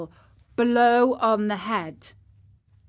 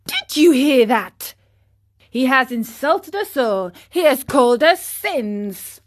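higher, loud outrage; indignant; 4 semitones higher, 6 dB louder than usual. Acted. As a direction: protest the insult to the gang. Boys outraged at an insult.

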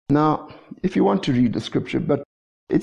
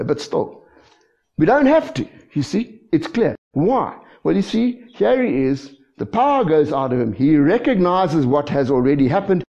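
ordinary talk

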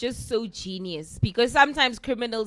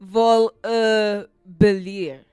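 mock French accent, sounding out the phrase; hammed-up French pronunciation of "vol, uh, belire"; amateur trying a French accent; 2 semitones lower, much slower than usual.

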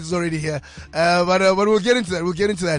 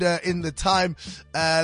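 ordinary talk

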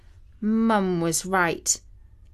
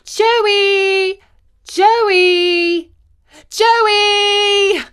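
long, high, loud calls across the common; calling out; 12 semitones higher, 8 dB louder, much slower than usual. A narrator's reading. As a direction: call the lost dog's name loud and high. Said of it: "The two girls called out".

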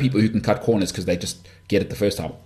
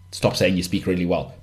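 normal talking voice

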